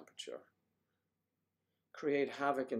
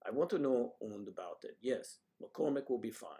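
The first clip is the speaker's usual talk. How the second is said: normal talking voice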